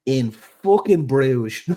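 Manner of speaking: put-on slow, drawn-out Dublin accent with a laugh; a broad Dublin accent, in on the joke. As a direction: lean into the Dublin accent, stretching every word. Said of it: "can you do it in your best Dublin accent".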